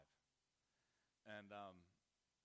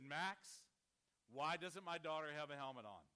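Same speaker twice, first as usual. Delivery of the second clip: louder, firm confrontation; stern loud demand; 4 semitones higher, 9 dB louder than usual. Retold or imitated. Father confronting boyfriend on the porch, loud and stern. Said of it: "I'm talking like this".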